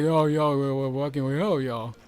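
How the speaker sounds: loud, mumbled street-style Stallone; a loose, off-target Stallone voice; Stallone impression. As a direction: slur it like a tough-guy hero.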